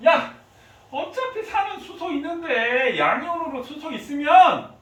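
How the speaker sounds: loud, high-pitched mock argument; insistent call-out. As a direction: reason it out loudly, as if arguing for a shortcut. Voices scientists' shortcut reasoning.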